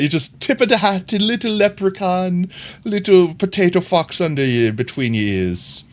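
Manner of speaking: playful fake Irish accent; bad Irish accent. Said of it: "I don't know what that accent was".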